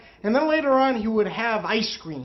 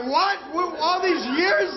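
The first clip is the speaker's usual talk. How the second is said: shocked disbelief; 6 semitones higher, slower than usual. Retold or imitated. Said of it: "And I'm like".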